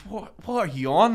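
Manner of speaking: retold indignant demand; acted confrontation. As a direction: square up to him in mock outrage.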